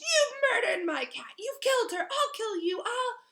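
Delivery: very high, loud raging threats; furious screaming. Acted. Filch raging at Harry.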